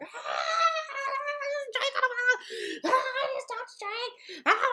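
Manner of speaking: high, slow, laughing squeals; fangirl screaming. Retold or imitated. Two friends in the bathroom squealing over a [name] poster, high laughing squeals. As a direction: squeal it out like a starstruck fan. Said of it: "we were screaming".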